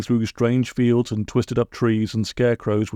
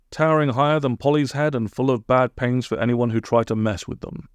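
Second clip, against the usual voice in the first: ordinary delivery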